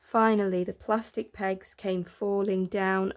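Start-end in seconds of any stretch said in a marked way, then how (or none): none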